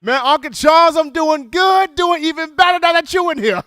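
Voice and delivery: high, loud delighted welcome; light up as a favourite regular calls in; excited greeting. Real, not acted. Host thrilled to hear Uncle Charles on the line, high and loud.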